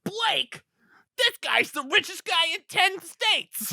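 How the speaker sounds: high, slow, awestruck elf voice; amazed elf. Retold or imitated. The elf reads the wallet's ID, in a high slow awestruck voice. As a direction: marvel at the ID. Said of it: "he goes".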